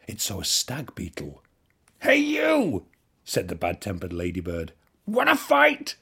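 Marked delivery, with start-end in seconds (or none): 2.0-2.9 challenging shout. A narrator's reading. Ladybird picks a fight. 5.1-6.0 challenging shout. A narrator's reading. Ladybird picks a fight.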